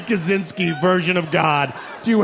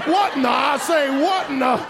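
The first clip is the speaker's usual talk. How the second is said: Foghorn Leghorn; 10 semitones higher than usual. Retold or imitated. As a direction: bluster it like the cartoon rooster. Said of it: "sound like Foghorn Leghorn".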